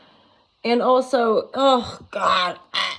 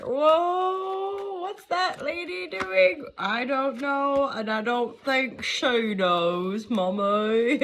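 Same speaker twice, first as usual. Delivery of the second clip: baffled onlookers; 3 semitones higher, 3 dB quieter, much slower than usual. Pretend. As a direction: draw it out like a bewildered kid asking Mommy. Acts out baffled viewers and child.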